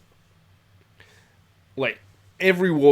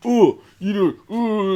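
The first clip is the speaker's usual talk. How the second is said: mock squaring up; 10 semitones higher, 7 dB louder, slower than usual. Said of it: "he tries to square up on Ian".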